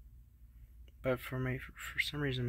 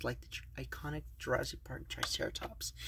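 whispering; 7 dB quieter than usual. Real, not acted. Unboxing whisper to camera.

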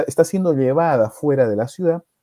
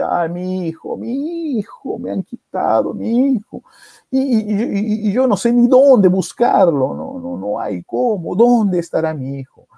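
acted tearful lament, pitched up; mock crying lament; 6 semitones higher than usual. Young mother weeping for her stolen son, wailing. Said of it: "Y se pone a llorar. Y llora, y llora".

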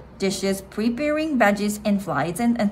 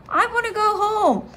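homesick cry; 11 semitones higher than usual. Acted. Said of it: "cried Froggy".

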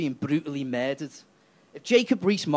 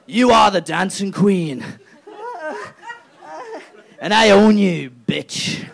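gloating taunt; 6 dB louder, slower than usual. Retold or imitated. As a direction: lord it over her. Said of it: "tree taunting dancing Theresa".

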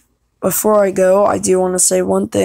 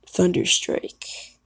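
whispered name; 5 dB quieter, much slower than usual. Shown as a demonstration.

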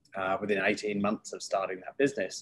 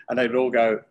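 ordinary delivery

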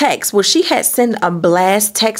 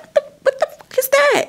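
much higher, alarmed outburst; startled shock. Retold or imitated. Hearing pops hit her window, startled shriek. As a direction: jump at the noise in alarm. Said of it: "I'm like".

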